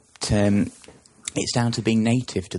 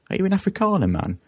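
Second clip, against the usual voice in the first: much higher teasing question; mock Afrikaner question; 11 semitones higher than usual. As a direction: squeak it up like a taunting local. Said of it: "caught several of them out now saying".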